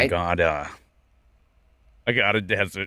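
low, put-on Australian accent. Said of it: "I have to get Australian".

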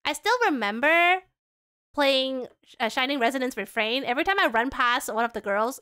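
ordinary talk, nothing marked

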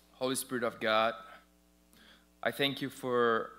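normal talking voice

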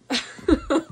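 quick rising laugh; bright laugh. Real, not acted. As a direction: laugh at your own cheek. Laughs at own joke.

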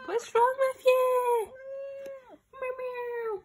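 very high, loud voice at the cat; high loud cat talk; pet talk. Real, not acted. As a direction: squeak at the cat in sing-song. Talking to the cat.

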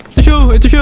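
loud, high sudden yell; startled shout. Real, not acted. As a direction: yell it in a jolt of fright. Monster found suddenly.

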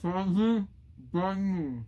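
garbled through a packed mouth; barely able to speak, mouth crammed; cheeks stuffed full. Real, not acted. Mouth packed, words barely come out.

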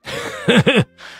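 high, short scornful laugh; an official sneering at a staff officer; cold sneering laugh. Acted. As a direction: laugh at him coldly. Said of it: "送上一句冷笑".

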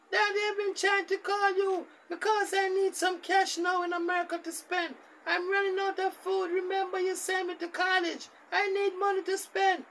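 put-on high falsetto young girl voice; daughter's voice. Daughter calling her busy father, high girlish falsetto. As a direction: squeak it up like a whiny daughter on the phone.

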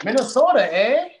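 mock Minnesota accent, high and loud; hammed-up Minnesotan twang on eh. Teasing the host about her Minnesota roots, mock Minnesota accent. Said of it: "that was pretty good fake though".

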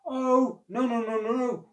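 high, loud string of denials; hasty denial. A narrator's reading. Crocodile reassuring monkey about drowning, hasty denial. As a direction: brush the worry away in a tumble.

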